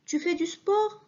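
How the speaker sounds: "sport" rising high at the end; rising question. Shown as a demonstration. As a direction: ask it, pitch climbing on "sport". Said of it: "هنا أنا أسأل". (سؤال بنبرة صاعدة على "sport")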